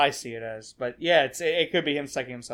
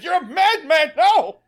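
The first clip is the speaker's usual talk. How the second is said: high, loud comic accusation; frantic cartoonish yelling; mock panicked shout; 11 semitones higher, 7 dB louder than usual. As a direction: cry out in hammed-up horror. Acts groundhog shouting at the driver.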